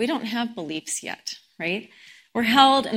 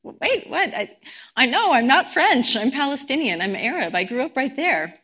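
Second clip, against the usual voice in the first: acted stunned protest, higher pitch; 4 semitones higher than usual. Palestinian man answering a stranger's claim he's French, retold as stunned. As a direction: stammer a baffled protest, imitating someone caught off guard. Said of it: "this Palestinian guy is stunned".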